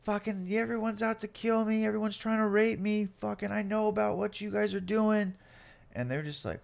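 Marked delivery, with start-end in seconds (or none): 0.0-5.3 mock screaming and crying. Arrested man to police, re-enacted frantic high cry. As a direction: imitate a panicked, wailing rant. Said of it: "I'm screaming and crying at this point".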